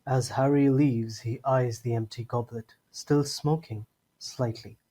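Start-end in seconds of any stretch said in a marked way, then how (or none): none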